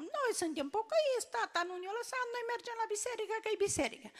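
lightly voiced child insisting they go to church; child pleading. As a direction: plead like a small child defending herself. Children tell the school director.

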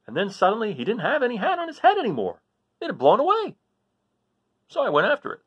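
put-on animated storyteller voice for a children's book; lively story reading. A narrator's reading. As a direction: read it with wide swings in pitch, bright and eager. Reads Toto's story aloud.

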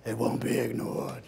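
low, soft menacing hiss; ominous. Acted. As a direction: creep it out like a stalker. Fatal Attraction menace.

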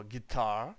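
guitar with the stress on TAR; stress on second syllable. Shown as a demonstration. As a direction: say guitar leaning on the last syllable. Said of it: "Наголос на другий склад".

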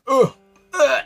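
loud grossed-out ugh; disgusted groan. Real, not acted. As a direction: groan at the bad smell. Disgusted by smelly water.

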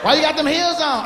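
put-on high, slow, overly sweet girl voice; fake cheerful girlfriend. Woman hyping up her friend, high syrupy mock voice. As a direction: gush fake encouragement.

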